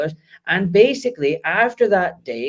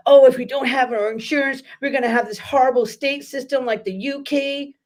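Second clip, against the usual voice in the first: mock worried American; 7 semitones higher than usual. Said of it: "the Americans will go".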